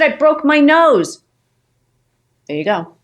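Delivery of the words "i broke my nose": high, loud and slow lament; to the husband, a high loud slow wail; distressed wail. Retold or imitated. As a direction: wail it out slowly. Telling husband the injury.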